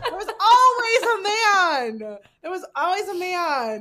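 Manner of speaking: loud, high, drawn-out laughing cry; big self-teasing shout with laughter; laughing exclamation. Real, not acted. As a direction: holler it while cracking up. Laughing at own live oversharing.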